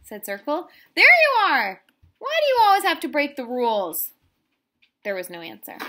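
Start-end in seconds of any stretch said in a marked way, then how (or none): 1.0-4.1 angry scolding. Acted. Circle scolds rule-breaking friend.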